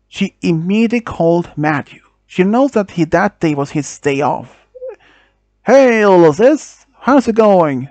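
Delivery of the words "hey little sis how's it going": loud, high big-brother hello; hearty greeting. Acted. Brother answering his sister's call, heartily greeting her. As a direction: greet a little sister with raised, lifted voice.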